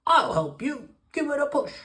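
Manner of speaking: high-pitched frog character voice; high, eager croak for a kind frog. A narrator's reading. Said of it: "and croaks".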